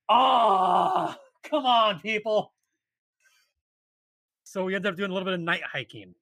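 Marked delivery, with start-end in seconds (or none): 0.1-2.5 high, loud mock outrage; exasperated hiker scolding unprepared strangers, high and loud; exasperated come on. Real, not acted. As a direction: throw up your hands at them.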